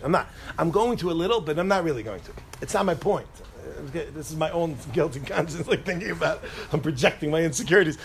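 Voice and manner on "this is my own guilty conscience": normal talking voice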